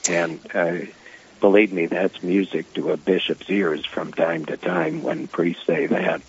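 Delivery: speaking over a telephone; phone line. Real, not acted. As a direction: bishop calling in by phone. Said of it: "Bishop Kagan is on the phone".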